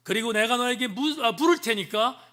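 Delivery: God telling Job to answer him; voicing God. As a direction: demand it sternly from above. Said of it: "이렇게 말합니다".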